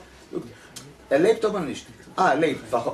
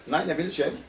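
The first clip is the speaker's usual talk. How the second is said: ordinary talk, nothing marked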